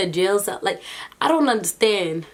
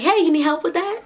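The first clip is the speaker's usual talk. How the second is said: higher, eager cop voice, a bit louder; cheery officer offering help; mock helpful cop; 10 semitones higher, 3 dB louder than usual. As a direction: play the cop, way too helpful. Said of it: "And the officer's like".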